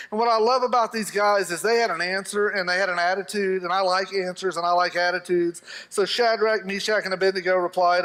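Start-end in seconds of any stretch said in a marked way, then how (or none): none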